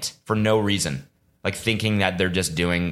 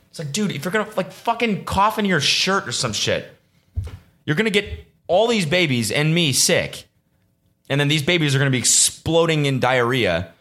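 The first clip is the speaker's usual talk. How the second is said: mock rant; 9 semitones higher than usual. Ranting at coughing seatmate.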